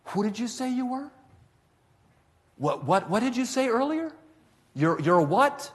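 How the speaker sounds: high-pitched, incredulous questioning, acted out; stunned disbelief. Official taken aback by the grandmother's faith, incredulous high voice. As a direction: leap up in pitch as if you misheard.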